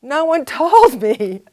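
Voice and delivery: high, loud, slow, laughing through the words; loud high laughing complaint; laughing protest. Retold or imitated. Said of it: "I'm like".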